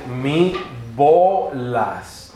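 "Mi bolas" said very slowly, ending with a fall; slow, drawn-out Esperanto phrase. Shown as a demonstration. Teacher acting a boy at the congress, saying it slowly. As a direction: stretch "Mi bolas" slowly, syllable by syllable. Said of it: "y el chico dice".

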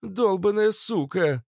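high, drawn-out indignant female outburst; exclaiming. A narrator's reading. Фитилёк swears on hearing the news, high indignant exclamation. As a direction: cry out a slow, high-pitched curse. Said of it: "воскликнула Фитилёк".

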